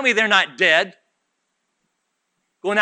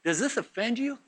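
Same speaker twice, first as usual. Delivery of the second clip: mock apology; 7 semitones higher than usual. Jesus mockingly apologising to offended disciples, sarcastic. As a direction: feign sorry, tongue in cheek. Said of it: "he said unto them".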